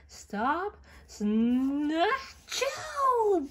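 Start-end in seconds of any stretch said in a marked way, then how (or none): 1.9-3.5 big sneeze. Acted. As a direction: blast out a huge sneeze. Gerald sneezes.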